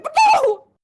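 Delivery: high loud wail; wailing refusal. Retold or imitated. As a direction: cry out no. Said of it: "And he went".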